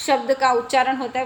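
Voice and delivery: ordinary delivery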